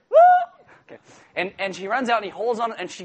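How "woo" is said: short high whoop; mock startled yelp. Preacher imitating Joseph fleeing the robe-grab, startled whoop. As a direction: yelp as if grabbed. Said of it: "That's my impression".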